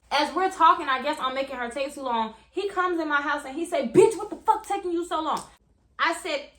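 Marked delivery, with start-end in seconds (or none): 3.9-5.4 put-on higher and louder, furious yelling at his girlfriend; angry boyfriend. Boyfriend yelling at girlfriend for his keys, furious. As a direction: bark it like a furious man demanding his car.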